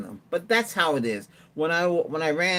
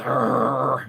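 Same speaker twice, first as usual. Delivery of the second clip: a loud rolling growl like a guard dog; imitated dog growl; 5 dB louder than usual. Owner shows the robot dog's growl, loud rolling growl. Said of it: "It goes".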